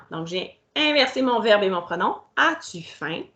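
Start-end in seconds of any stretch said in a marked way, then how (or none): none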